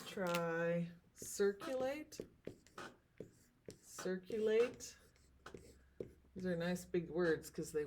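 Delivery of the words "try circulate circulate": circulate said slowly, each syllable apart; slow syllable demo. Shown as a demonstration. Teacher models circulate for class, slowly by syllables. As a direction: draw circulate out slowly beat by beat. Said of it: "let's try that with a few more words".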